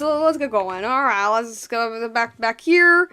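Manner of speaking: ordinary delivery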